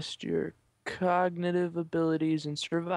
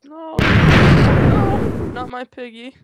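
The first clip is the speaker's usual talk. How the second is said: mock grief; 8 semitones higher, 15 dB louder, slower than usual. Mourning the lost pig.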